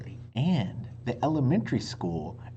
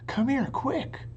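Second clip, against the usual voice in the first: Annie urging; 12 semitones higher than usual. A narrator's reading. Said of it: "Annie shouted".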